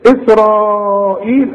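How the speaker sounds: إسرائيل with the long ي drawn out, slow; stretched madd. Shown as a demonstration. Teacher shows the obligatory madd, slowly. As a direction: drag out إسرائيل for four counts. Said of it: "أهو ده المد الواجب".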